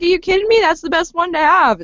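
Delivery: high-pitched, incredulous retort; mock disbelief. Friend envying those who have visions, playfully incredulous. Acted reply to reluctant gifted people.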